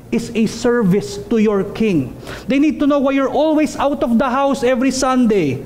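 normal talking voice

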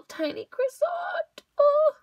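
very high, tiny squeal; cooing delight. Real, not acted. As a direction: squeak it as if melting over something tiny. Cooing over the tiny croissant.

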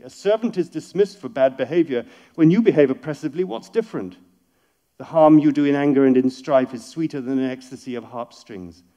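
loud, urgent declamation; dramatic poetry reading. Acted. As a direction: proclaim the lines with passion. Reads the verse aloud.

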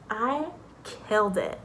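usual speaking voice

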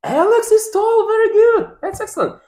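high, loud delighted praise; excited praise. Real, not acted. Teacher praising young child.

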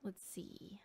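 very soft whisper to self; barely audible whisper; whispered aside. Real, not acted. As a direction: whisper it absently under your breath. Muttering to self while checking screen.

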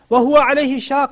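ordinary delivery

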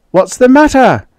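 high, loud and urgent over the radio; frantic demand. A narrator's reading. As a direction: demand it in a panic. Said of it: "Ned's voice demanded frantically".

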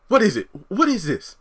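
high, loud demanding Karen; Karen impression. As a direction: question him sharply in her voice. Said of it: "Karen impression".

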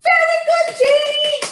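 very high squeal of praise; delighted praise. Real, not acted. Auntie praising Janie, excited and high.

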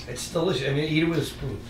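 sarcastic. Real, not acted. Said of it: "your sarcasm".

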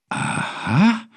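mild surprise, rising sharply; questioning, understated amazement; slightly astonished. A narrator's reading. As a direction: let it lift in mild wonder. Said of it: "nur ein bisschen erstaunt".

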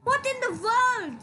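high, startled what in the world; what in the world, hit what hard in disbelief; shocked exclamation. Real, not acted. Player stunned by the other players' vote, exclaims in disbelief. Reacting to the vote going wrong.

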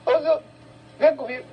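high, commanding officer call; stern officer voice. Retold or imitated. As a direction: order him to come along. Acting the arresting officer.

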